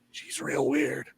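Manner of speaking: breathy whisper; whispered aside. Acted. Hutch whispers to Fish about the woman. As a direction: whisper it behind her back. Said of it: "he goes".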